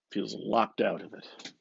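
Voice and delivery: ordinary delivery, nothing marked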